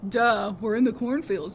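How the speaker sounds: slow loud mocking duh. A narrator's reading. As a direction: roll your eyes at the obvious. Miles mocks Henry.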